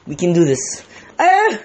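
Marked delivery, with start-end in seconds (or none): 1.2-1.6 startled yelp. Real, not acted. Water splashing into hot pot.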